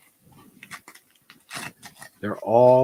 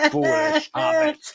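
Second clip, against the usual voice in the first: high, slow gloating villain; 4 dB louder, slower than usual. Pretend. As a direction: sneer it like a wizard villain. Voicing snickering villain friend.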